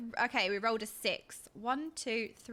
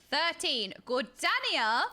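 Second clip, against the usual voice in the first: ordinary delivery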